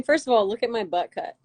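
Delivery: ordinary talk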